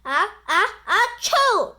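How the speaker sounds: high, loud sneeze with a laugh; fans sneezing. Acted. Fans echo the cheerleaders' sneeze, loud and high. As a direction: echo the sneeze back, giggling. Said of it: "repeated the fans".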